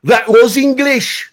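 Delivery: deadpan Scottish put-down, drawn out; Scottish old man. Retold or imitated. Old Scotsman leaning in to tell the kid it was English, in a Scottish accent. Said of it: "he leans forward and he says".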